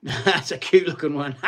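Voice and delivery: amused, laughing through the words; real laugh around the remark. Real, not acted. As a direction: say it with a real chuckle.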